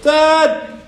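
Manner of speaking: loud, high-pitched holler; shout. A narrator's reading. Boy calling for his father across the bay, shouting. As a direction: yell it out to carry far. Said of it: "he shouted".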